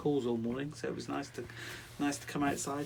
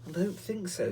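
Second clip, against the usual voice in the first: sad; 7 semitones higher than usual. Acted. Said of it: "said Chinky sadly".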